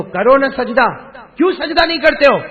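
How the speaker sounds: loud, high, wrathful demand; thundering, insistent rebuke; angry command. Acted. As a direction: shout the order in acted anger. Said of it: "اب غصے میں اللہ کہے گا".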